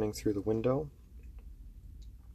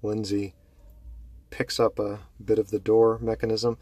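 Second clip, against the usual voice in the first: usual speaking voice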